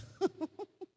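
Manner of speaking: high-pitched laugh; short, high real laugh. Real, not acted. Woman testifies about her brother's ministry, real high laugh.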